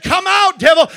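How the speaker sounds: loud, high command to the devil; loud retold command to the devil; commanding shout. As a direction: bark the devil out. Retelling the deliverance command.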